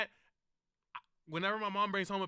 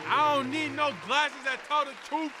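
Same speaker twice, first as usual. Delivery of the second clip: hyped up; 7 semitones higher, 3 dB louder than usual. Real, not acted. Hyped rant.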